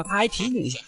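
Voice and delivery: usual speaking voice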